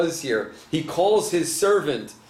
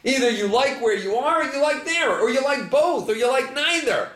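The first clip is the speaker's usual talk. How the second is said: pitched well up, pressing the puzzle; rising disbelief, pushing the contradiction; incredulous questioning; 10 semitones higher than usual. Real, not acted. Rabbi to the congregation, asking in incredulous, raised voice.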